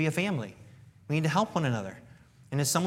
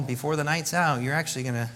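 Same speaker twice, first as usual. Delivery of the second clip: ordinary talk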